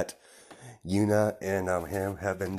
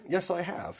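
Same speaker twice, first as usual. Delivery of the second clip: much higher, softer, smug reply; smug sister voice; 10 semitones higher, 5 dB quieter than usual. Retold or imitated. Yuna admits to Overhaul, high and smug. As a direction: answer her brother with sly pride. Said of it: "and she's like".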